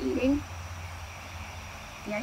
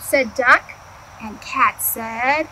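ordinary delivery

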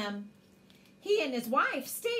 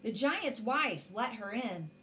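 plain reading voice

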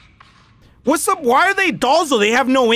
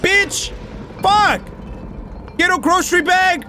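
frustrated shouting; 8 semitones higher than usual. Real, not acted. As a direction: bark orders at your useless helper. Yelling at ally during fight.

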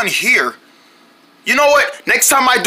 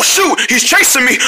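ordinary delivery

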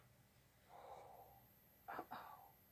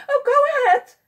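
high, loud and eager; excited; 10 dB louder, much faster than usual. A narrator's reading. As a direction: urge her on, thrilled. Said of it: "the pollywog said excitedly".

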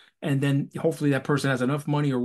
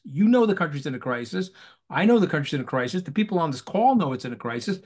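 ordinary talk, nothing marked

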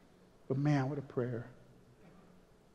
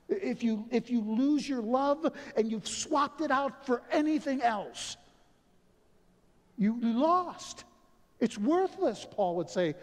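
preaching at full force; 8 semitones higher than usual. Acted. Pastor urging the church to love, preaching hard. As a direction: preach it out to the congregation.

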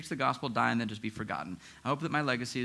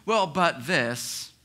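very slow, louder drawn-out protest; defensive pushback; 4 semitones higher, 6 dB louder, much slower than usual. Retold or imitated. Pastor mocking a confronted sinner's slow loud protest. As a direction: stall with a grudging well-but. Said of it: "someone confronts you in sin and you go".

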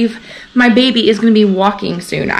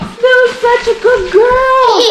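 potty training praise; 11 semitones higher than usual. Real, not acted. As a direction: gush over the potty win.

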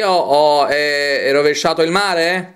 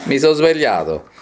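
ordinary talk

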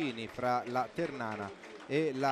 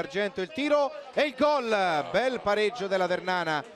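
excited goal call; 3 semitones higher, 4 dB louder than usual. Real, not acted. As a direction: burst out calling the goal. Goal call in live commentary. (telecronaca del gol esaltata)

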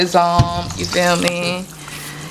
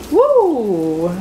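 excited whoop; 3 semitones higher than usual. Real, not acted. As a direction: cheer at the food. Chicken coming out.

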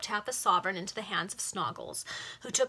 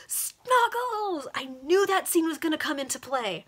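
real outburst, much higher and louder; excited exclamation; 8 semitones higher, 7 dB louder than usual. Real, not acted. Reader reacting to Snoggles turning up, thrilled and loud. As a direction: squeal it out as a called prediction.

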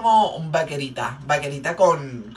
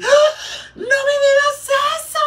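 very high, loud gasping squeal; shocked client; 6 dB louder than usual. Retold or imitated. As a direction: shriek it in exaggerated disbelief. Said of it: "y ella así". (chillido agudísimo de asombro exagerado)

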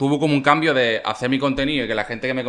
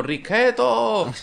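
high, drawn-out mock yell of his nickname; imitated street shout; 9 semitones higher, much slower than usual. Fans yelling his name from the street, mock high shout. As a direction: stretch the name out like a fan calling from below. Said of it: "peña abajo gritando".

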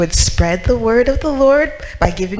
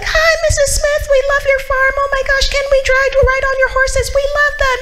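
put-on much higher, bubbly child voice; excited kids; faster than usual. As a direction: gush like eager little kids at the farm. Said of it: "they'd be like".

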